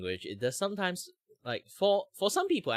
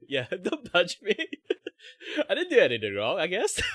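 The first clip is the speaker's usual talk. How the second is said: laughing mock plea; 11 semitones higher, 9 dB louder than usual. Joking about being scolded in German.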